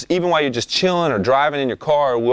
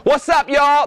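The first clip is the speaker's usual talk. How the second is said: much higher, louder hype call; highest tone; 4 dB louder, slower than usual. Shown as a demonstration. Rapper demos his highest, loudest tone. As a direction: shout it out at the top of your range. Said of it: "That's at the highest tone".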